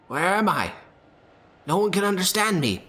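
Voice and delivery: high, strained lost-creature voice; a frightened monster asking where it is; high confused creature voice. Acted. Crow creature speaks again.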